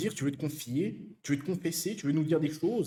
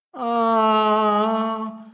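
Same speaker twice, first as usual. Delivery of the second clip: mock cry; 5 semitones higher, 5 dB louder than usual. Exaggerated reaction to smoker.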